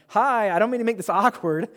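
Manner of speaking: high, loud, awkward hello; acted-out awkward approach to strangers; awkward greeting. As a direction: break the ice too eagerly. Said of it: "this is gonna be awkward".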